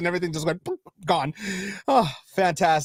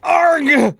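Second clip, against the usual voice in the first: a sharp, loud, higher groan of annoyance; frustrated yell; 8 semitones higher, 9 dB louder than usual. Real, not acted. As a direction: blurt out real frustration at the mouse. Stream cut out by accident.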